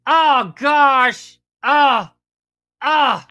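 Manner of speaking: loud, high, hammed-up groans; exaggerated outcry of pain; mock anguished groan. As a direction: wail at the terrible pun. Groaning at a bad joke.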